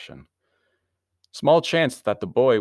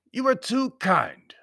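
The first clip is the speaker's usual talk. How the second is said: high, slow, louder formality; stiff Baron; 5 dB louder, slower than usual. A narrator's reading. Baron acknowledging a compliment, high and stiff. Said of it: "he said stiffly".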